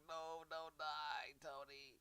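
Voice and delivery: slow, high put-on wail; hammed-up tearful begging; mock pleading. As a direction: plead like a weeping fan, stretching the words. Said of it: "She's like".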